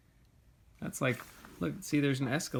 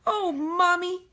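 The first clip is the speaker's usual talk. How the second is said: put-on high, slow little girl's plea; 6 dB louder, slower than usual. A narrator's reading. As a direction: voice the excited little girl asking her mommy. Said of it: "she said".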